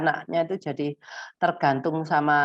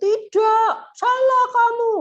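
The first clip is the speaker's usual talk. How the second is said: high, loud telling-off of a wrong answer; harsh scolding; 7 dB louder, slower than usual. Shown as a demonstration. Demo of how not to tell a child it's wrong, harsh scolding. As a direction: snap it at the child as a reprimand. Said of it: "Nggak, jangan pakai, pakai nada yang netral aja".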